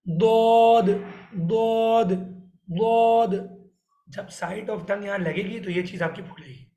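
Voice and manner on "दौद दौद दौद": "दौद" loud and heavy, with a faint "दाल" sound under it; correct heavy letter. Shown as a demonstration. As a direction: repeat "दौद" forcefully, side of tongue on molars. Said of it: "फिर आपको दिखाता हूँ". (ज़ोरदार सही "दौद")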